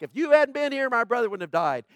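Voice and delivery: high, loud, accusing protest; Martha's frustrated complaint. Retold or imitated. Martha confronting Jesus over her dead brother, loud and accusing. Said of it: "Martha was like".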